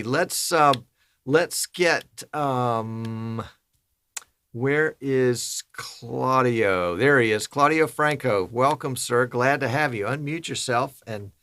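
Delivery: ordinary delivery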